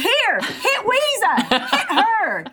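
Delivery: high, slow, laughing mock offer; Olympia Dukakis impression. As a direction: hand her over with amused drama. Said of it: "She goes".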